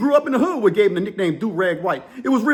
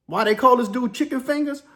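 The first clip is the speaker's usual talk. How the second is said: ordinary delivery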